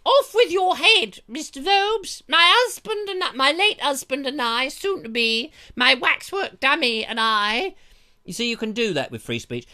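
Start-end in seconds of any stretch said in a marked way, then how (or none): 0.1-7.7 put-on high, loud regal old lady voice; imperious mock royal decree; hammed-up queen; Queen Victoria. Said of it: "I'm going into Queen Victoria".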